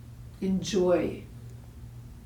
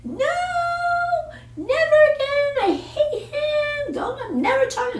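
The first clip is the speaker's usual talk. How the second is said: much higher, louder mock teenage wail, half laughing; upset teen daughter; 5 dB louder than usual. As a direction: imitate a sobbing, furious daughter. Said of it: "And she went".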